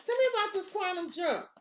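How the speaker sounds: normal talking voice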